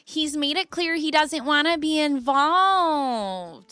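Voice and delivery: high, drawn-out syrupy put-on accent; Brenda's put-on voice. Reader voices Brenda consoling a girl, high exaggerated drawl. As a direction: stretch the vowels slowly, pitched well up. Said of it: "What accent does, what, does Brenda have?".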